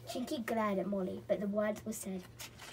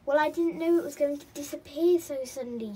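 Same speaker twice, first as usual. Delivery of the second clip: louder, higher storybook protest; defensive; 5 semitones higher, 10 dB louder than usual. A narrator's reading. Molly excuses herself to Chinky, defensively. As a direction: protest like a child being blamed.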